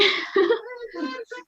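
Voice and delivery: laughing and crying at once; laughing through tears; tearful laugh. Real, not acted. Overwhelmed winner.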